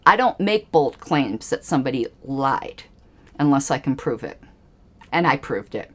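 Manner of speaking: ordinary delivery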